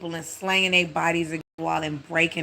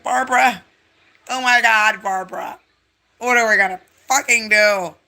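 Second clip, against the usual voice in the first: hammed-up panicked husband, louder; 3 semitones higher, 6 dB louder than usual. The husband yelling to his wife in the elevator, mock panic. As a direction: yell his wife's name in mock panic. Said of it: "They're crying".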